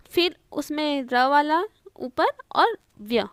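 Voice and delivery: ordinary talk, nothing marked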